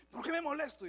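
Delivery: loud, high flare-up of temper; angry outburst. Retold or imitated. Short-tempered son blurting out why he gets angry, loud and high. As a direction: snap as if you can't help it.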